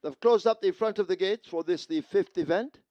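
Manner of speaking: loud, high PA call; urgent call over the track speakers; through loud speakers. Real, not acted.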